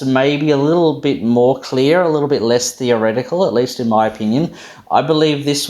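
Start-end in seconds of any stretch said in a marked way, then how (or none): none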